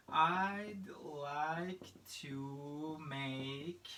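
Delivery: very slow, drawn-out words; slow teacher modelling. Shown as a demonstration. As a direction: stretch each word out for learners to copy. Models phrase slowly for students.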